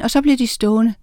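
ordinary delivery, nothing marked

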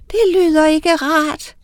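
high, sympathetic tiny witch; a tiny witch frowning at the news, high; little witch voice. A narrator's reading. Tiny witch pities the orphans, high voice. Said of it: "sagde den lille heks".

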